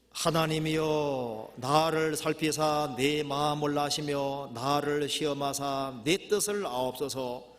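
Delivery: loud, slow, drawn-out congregational reading; scripture reading. Acted. Preacher leading the congregation through a psalm, solemn scripture reading. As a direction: intone the psalm in long, sustained phrases.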